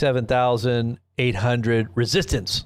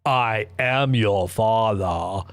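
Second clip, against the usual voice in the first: Trump as Vader; 2 semitones lower, much slower than usual. Retold or imitated. Trump tells his followers, in a slow, hammed-up villain voice. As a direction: draw out the reveal like a movie villain. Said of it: "this is what Trump says to maga".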